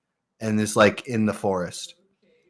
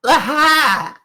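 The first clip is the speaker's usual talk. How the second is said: put-on gleeful toddler; 3 dB louder than usual. Toddler playing with cat.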